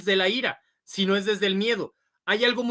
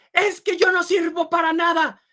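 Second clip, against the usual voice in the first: put-on higher, hurt child's voice; child's complaint; 8 semitones higher, 4 dB louder than usual. Child telling parent he's useless, mock-dejected. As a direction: play a discouraged kid who gives up. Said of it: "cuando su hijo les diga".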